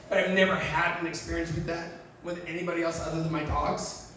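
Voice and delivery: ordinary talk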